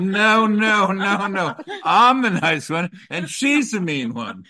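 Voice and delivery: high, loud insistent correction; playful protest. Real, not acted. Host correcting who is the nice one, loud mock protest. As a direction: protest loudly, pitched well up.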